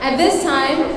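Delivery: normal talking voice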